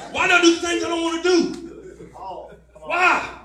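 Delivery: despairing shout about his own sin; anguished cry. Retold or imitated. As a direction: cry out in frustration at yourself. Crying out over his own sin.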